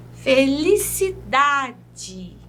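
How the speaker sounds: "Felicidade" slow, DA drawn out; penultimate stress. Shown as a demonstration. Teacher listing examples, "Felicidade" slowly with DA stressed. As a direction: stretch "Felicidade" syllable by syllable, strong da. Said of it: "Vejam mais algumas".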